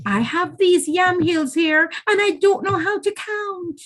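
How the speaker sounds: wheedling spider, begging in a high voice. A narrator's reading. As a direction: plead with her sweetly for help. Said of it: "Anansi begged".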